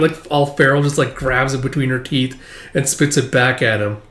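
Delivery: normal talking voice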